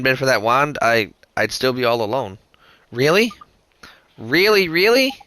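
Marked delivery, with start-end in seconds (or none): 2.9-5.1 put-on bright rising childlike voice; a small fairy asking eagerly, higher each time; squeaky little P. P eagerly asking Oliver, higher voice.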